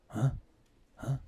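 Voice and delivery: ordinary talk, nothing marked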